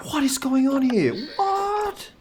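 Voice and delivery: high, squeaky panicked disbelief; shocked disbelief. Real, not acted. Player finds all the zoo animals dead, high shocked squeak. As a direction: shriek it as the animals all die.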